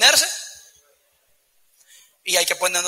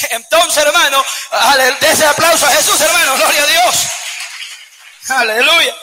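loud, high call to applaud Jesus; rousing preaching; 6 semitones higher, 6 dB louder than usual. Acted. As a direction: fire the congregation up for applause. Preacher rouses applause at full force.

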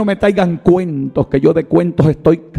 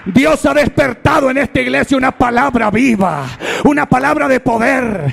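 higher and higher, ending in shouted promises; rousing preaching; 10 semitones higher than usual. Acted. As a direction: lift the church with God's promises. Preaching climax. (predicación exaltada que termina en gritos)

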